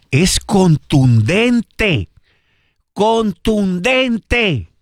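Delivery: CONTUNDENTE drawn out slowly, syllable by syllable; emphatic. Real, not acted. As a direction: stretch contundente out slowly for weight. Emphatic verdict on the paragraph.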